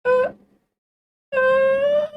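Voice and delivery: very high, loud, strained uh into a laugh; high note attempt. Shown as a demonstration. Testing her full range for the listener, very high then laughing. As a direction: push to the top of her range and crack up. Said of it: "But let me see".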